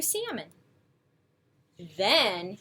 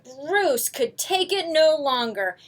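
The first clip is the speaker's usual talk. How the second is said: plain reading voice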